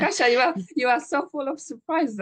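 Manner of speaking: genuinely delighted praise; delighted surprise. Real, not acted. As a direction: exclaim it, thrilled by her news. Delighted reaction to Kasia's news.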